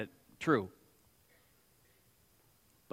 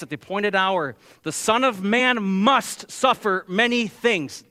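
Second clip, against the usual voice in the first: raised, louder pulpit voice; forceful preaching; 4 semitones higher, 6 dB louder than usual. Acted. Preacher to congregation on why blood must be shed, forceful preaching.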